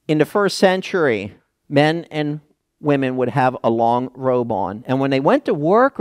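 ordinary talk, nothing marked

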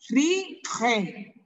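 RIGE in two separated beats, RI-GE, with a forceful throaty G; strong G. Shown as a demonstration. Teacher repeats the corrected word for pupils, strong G. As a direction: say "Rige" deliberately, hitting the raspy G. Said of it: "Fuerte ese G".

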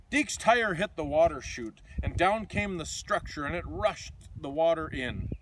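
lively, sing-song picture book reading; reading to children. A narrator's reading. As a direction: read it like a bedtime storybook for kids.

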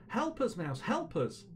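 high, pleading call; calling out desperately. A narrator's reading. Animals call after Mouse as she leaves, high plea. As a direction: call after someone for help, without real volume. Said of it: "The animals called after her".

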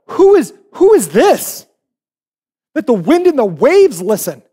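high, loud, slow acted amazement; astonished disbelief. Disciples scared of who's in the boat, astonished. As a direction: gape at it, dragging out the question. Disciples awed at storm being calmed.

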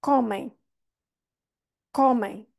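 Comem with open O and nasal ending; model pronunciation. Shown as a demonstration. Teacher modelling Comem, slow and clear. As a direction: say Comem with the stress on co and the mouth open at the end. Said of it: "é aí que está a rainha".

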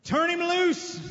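a touch of a triumphant order; commanding. Acted. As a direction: bark it as a command. Jesus commands loudly.

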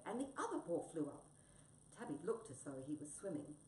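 usual speaking voice

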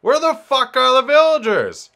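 very high, loud yell; frustrated shout. Real, not acted. Player cannot find any villagers, high frustrated shout.

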